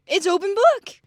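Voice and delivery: high, put-on voice of a smug dad; imitating Dad. Retelling Dad's Uno excuse to the listener, high mocking voice. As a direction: play the dad insisting it's open book, lightly mocking. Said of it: "And he's like".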